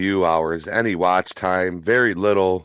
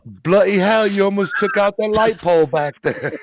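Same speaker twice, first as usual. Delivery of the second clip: mock British cop, much higher and louder; hammed-up British accent; 12 semitones higher, 5 dB louder than usual. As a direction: overplay a posh Brit yelling at a reckless driver. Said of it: "I can't do it".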